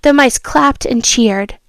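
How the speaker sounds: storybook reading voice; a child reading a fable aloud; story narration. A narrator's reading. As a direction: read it like a bedtime story. Reads fable, excited.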